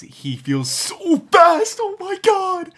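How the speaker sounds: high, loud, thrilled gasp of joy; so excited. Real, not acted. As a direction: burst out in delight at the speed. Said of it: "so excited".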